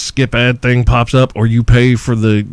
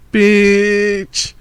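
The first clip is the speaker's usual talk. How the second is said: scoffing; 7 semitones higher than usual. Real, not acted. Scoffing at mandatory vaccinations.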